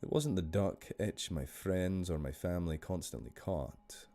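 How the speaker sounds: measured Scottish story narration; Scottish storyteller, unhurried; Scottish accent. Shown as a demonstration. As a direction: read the tale in a Scottish accent. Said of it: "I'm gonna do this one in a Scottish accent".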